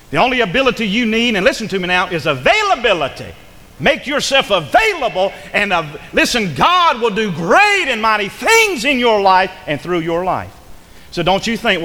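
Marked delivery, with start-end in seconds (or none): 0.1-10.5 raised, higher preacher's voice; forceful preaching. Real, not acted. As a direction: drive it home to the congregation.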